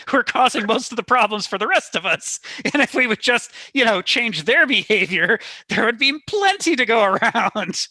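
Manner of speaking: half laughing as you press the point; amused exasperation. Real, not acted. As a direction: laugh through an animated argument. Laughing through a heated point.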